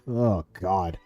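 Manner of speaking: ordinary talk, nothing marked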